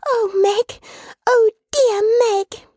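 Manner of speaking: put-on very high, tearful little-child voice; sobbing child. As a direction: cry out lovingly through acted sobs. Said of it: "in a burst of sobbing".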